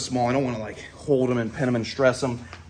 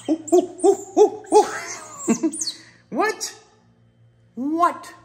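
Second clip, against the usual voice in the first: high, giggly coos; cooing at pet; 4 dB louder, much slower than usual. Real, not acted. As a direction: coo at the happy otter. Cooing at otter.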